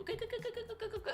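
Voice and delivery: high, soft, hurried mock urging; high hushed go go go; frantic urging. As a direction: squeak it like a friend shooing you off. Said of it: "And then they go".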